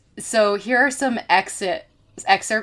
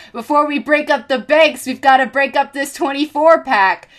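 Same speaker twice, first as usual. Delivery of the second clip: put-on high, loud bellow in a Brooklyn accent; Bernie's Brooklyn accent; 6 semitones higher, 6 dB louder than usual. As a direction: belt it to the crowd in a thick Brooklyn accent. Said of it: "bellowed in that unfaded Brooklyn accent".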